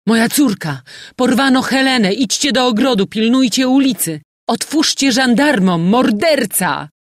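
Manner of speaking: high, loud, frantic alarm; alarmed shouting. A narrator's reading. General sending frightened servants after his daughter, shouting. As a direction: shout urgent orders to the whole house.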